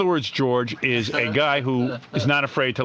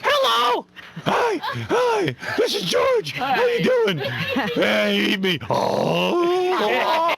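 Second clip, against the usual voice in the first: very high, shouted cartoon voice; George; much slower than usual. Host trying voices for a cartoonist, shrieked cartoon character. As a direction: yell it out in a squealing put-on pitch. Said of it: "Do George".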